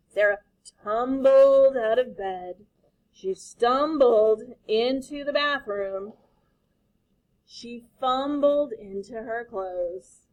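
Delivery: slow, savoured rhythm slowing further; slow rhythmic read. Shown as a demonstration. Teacher reading the rhyming words, slow rhythmic reading. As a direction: linger on tumbled, stumbled, fumbled. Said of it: "I love how the words do this too".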